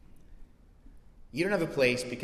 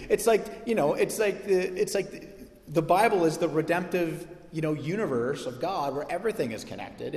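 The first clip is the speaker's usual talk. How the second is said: normal talking voice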